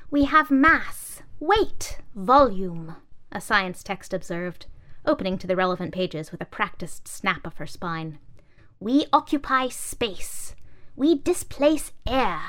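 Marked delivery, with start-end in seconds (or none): 0.1-3.0 high, loud, slow listing; prim science text. Acted. Science text lecturing the junk, high and slow. 8.8-12.4 high, loud, slow listing; prim science text. Acted. Science text lecturing the junk, high and slow.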